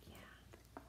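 breathy hushed yeah; whispering. Real, not acted. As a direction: whisper it softly. Whispered aside.

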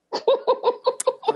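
high, hooting laugh; laughter. Real, not acted. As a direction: burst into high, airy laughter. Laughs at own Trump remark.

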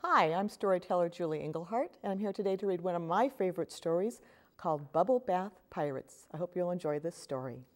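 put-on lively storybook read-aloud for kids; reading to children. A narrator's reading. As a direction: read it like story time at the library. Reads picture book to children.

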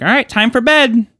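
high-pitched mock bedtime call; abrupt, high imitation of a friend; friend announcing bedtime. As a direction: sing it out high, mimicking the kid.

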